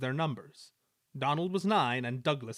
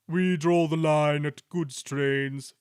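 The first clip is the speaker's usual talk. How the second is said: slow, louder, lordly engine voice; haughty Gordon; 3 semitones higher, 7 dB louder, slower than usual. A narrator's reading. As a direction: draw the line grandly. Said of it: "finished Gordon".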